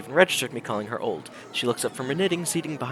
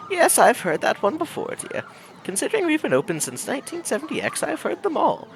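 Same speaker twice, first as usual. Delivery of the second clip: put-on sweet elderly shopkeeper, higher voice; old lady voice; 11 semitones higher than usual. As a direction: play the kindly old granny greeting a newcomer. Old owner replies to joke.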